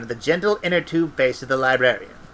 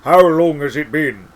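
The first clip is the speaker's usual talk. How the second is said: Cutangle voice; 6 dB louder than usual. Shown as a demonstration. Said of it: "Wait, no, that's Cutangle".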